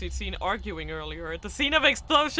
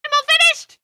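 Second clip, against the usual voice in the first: put-on much higher, loud bubbly girl's call; ebullient girl; 14 dB louder than usual. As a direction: burst in cheerful and bubbly. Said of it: "An ebullient voice resounded".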